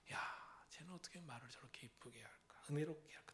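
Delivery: hushed wondering aside; admiring whisper. Retold or imitated. Seminary friend whispering admiration of a classmate. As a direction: whisper in awe.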